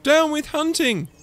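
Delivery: high, loud hooting chant; high-voiced little protester. A narrator's reading. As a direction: hoot it like a contrary slogan. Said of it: "he hooted".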